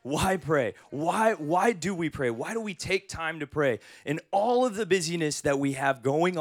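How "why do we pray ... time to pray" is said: ordinary delivery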